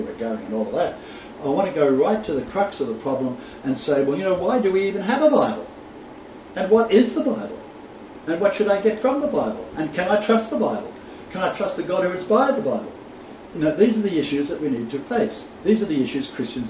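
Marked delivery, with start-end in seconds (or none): 4.0-12.9 higher-pitched, insistent string of questions; earnest questioning. Acted. Teacher voicing a doubter's questions to his class, earnest questioning. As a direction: voice a believer's pressing doubts, pitched up.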